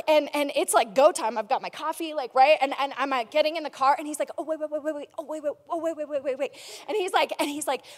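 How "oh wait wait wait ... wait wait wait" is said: rapid-fire string of little pleas; mock-frantic, words tumbling over each other; urgent stalling. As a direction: imitate someone hurriedly stopping a departure. Husband halting her departure.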